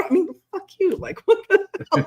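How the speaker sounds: laughing. Real, not acted. Laughing at the memory.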